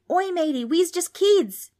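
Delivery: high, loud pleading shout. A narrator's reading. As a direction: shout in protest, drawing out the end. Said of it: "Darby shouted".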